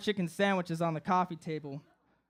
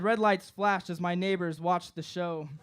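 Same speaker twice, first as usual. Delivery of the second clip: plain reading voice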